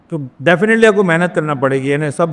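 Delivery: normal talking voice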